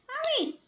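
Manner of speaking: high sharp ouch; real yelp of pain. Real, not acted. Owner yelping at bird bite, high pitched. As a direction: yelp as the beak bites.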